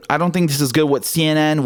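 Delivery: ordinary delivery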